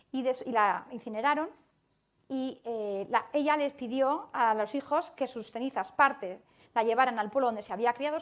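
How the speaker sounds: ordinary delivery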